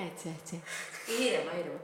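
very soft, low murmur; mock-ASMR quiet; calm. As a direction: hush it right down, soothingly. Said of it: "A S M R".

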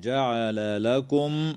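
جعل لكم with both Ls sounded distinctly; slow, precise جعل لكم; clear double L. Shown as a demonstration. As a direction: separate the two Ls in جعل لكم. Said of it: "تظهر اللام عند اللام".

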